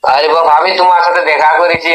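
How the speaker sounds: ordinary delivery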